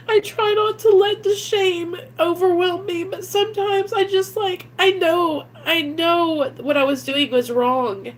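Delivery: high, tearful and breaking; real shame spilling over into sobs; crying. Real, not acted. Mother confessing her drug past to viewers, crying. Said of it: "I don't mean to get emotional".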